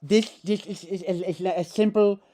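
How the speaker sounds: put-on stuttering, high lisped foreign accent; halting, insistent philosopher caricature; Zizek. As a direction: stammer along loudly, slurring each s. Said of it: "he's like".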